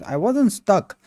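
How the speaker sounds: normal talking voice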